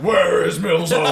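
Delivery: loud, high raging yell; full-out in-character shout. Acted. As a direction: bellow the question at your victim. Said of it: "And I'm yelling".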